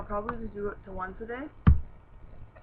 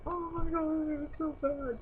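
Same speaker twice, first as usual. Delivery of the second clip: mock crying; 8 semitones higher, 3 dB louder than usual. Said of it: "I, not crying, like".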